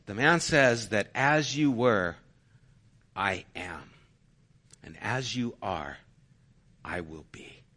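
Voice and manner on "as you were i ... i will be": solemn quote. Acted. Solemn words of quoted man.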